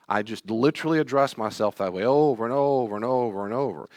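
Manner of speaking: ordinary talk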